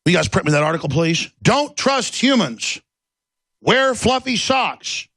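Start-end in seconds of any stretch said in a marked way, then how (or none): 1.4-5.1 mock robotic. Mocking Google's slogan.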